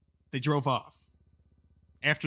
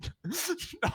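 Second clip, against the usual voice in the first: high laugh; 13 semitones higher, 8 dB quieter than usual. Real, not acted. Laughs at question.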